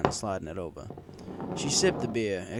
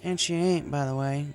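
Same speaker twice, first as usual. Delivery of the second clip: higher country mare voice, slurring a touch; Applejack voiced as drunk farm mare; 10 semitones higher, 5 dB louder than usual. A narrator's reading. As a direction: play the tipsy farm girl venting.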